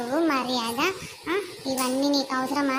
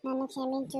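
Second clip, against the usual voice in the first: soft apology; 7 dB quieter than usual. Acted. Apologizing to maid.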